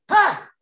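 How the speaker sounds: big, loud, high "ha" of breath; hard fight exhale. Shown as a demonstration. Coach showing hard breathing in a fight, explosive loud exhale. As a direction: blast all the air out at once. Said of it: "If I'm breathing really hard in a fight".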